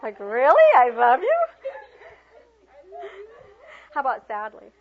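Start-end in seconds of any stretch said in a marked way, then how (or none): 0.2-1.4 loud, disbelieving rising question; incredulous question. Shown as a demonstration. Teacher showing the question take to class, incredulous. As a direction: ask it as if you can't believe it. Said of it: "How about with a question?".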